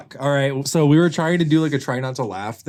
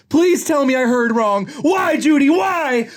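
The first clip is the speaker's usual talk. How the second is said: melodramatic, very high begging; anguished pleading; 13 semitones higher, 4 dB louder, slower than usual. Acted. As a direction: wail the questions, pitched way up. Nick begging in acted quarrel.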